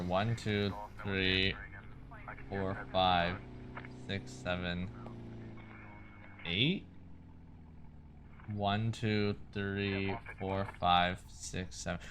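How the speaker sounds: quiet counting to himself; counting under breath. Real, not acted. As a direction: count the votes low, almost to yourself. Recounting votes quietly to himself.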